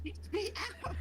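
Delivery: panicked plea. Acted. Mummified character begs.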